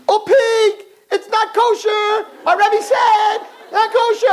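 put-on high, loud child's yell across the zoo; child screaming. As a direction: shriek it like an excited little grandson. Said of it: "he's screaming across the whole zoo".

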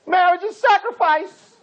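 lightly voiced kid blurting out an answer; mock kid's outburst. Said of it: "one kid screamed out".

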